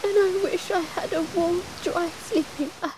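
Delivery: high, tearful fox voice with a sob; a little fox crying over a soaked bed. A narrator's reading.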